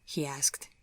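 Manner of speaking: ordinary delivery, nothing marked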